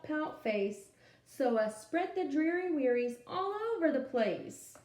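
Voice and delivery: lively storytime read-aloud; animated picture-book reading; reading to children. Acted. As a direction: read it out to a class of little kids.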